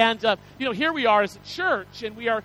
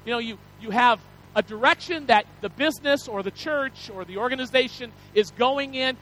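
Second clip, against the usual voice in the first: ordinary delivery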